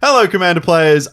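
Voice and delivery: ordinary talk, nothing marked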